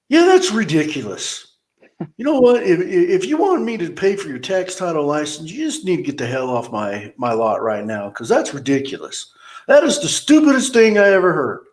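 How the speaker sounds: a hammed-up manager scolding customers; mock rant. Boss berating customers, retold.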